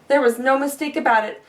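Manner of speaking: usual speaking voice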